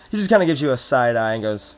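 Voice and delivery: usual speaking voice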